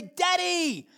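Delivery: high, loud delighted call; joyful call. Retold or imitated. Child running to dad, high joyful call. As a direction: call out running into his arms.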